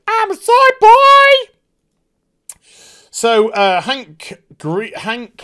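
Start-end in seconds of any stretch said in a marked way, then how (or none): 0.1-1.5 very high, loud, slow mock squeal; mock squeaky shout. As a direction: squeal it like a cartoon. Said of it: "Wynn's just like".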